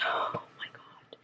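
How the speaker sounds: real emotion, voice falls to a whisper; moved whisper. Real, not acted. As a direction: let it catch in your throat and whisper. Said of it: "that part just got to me".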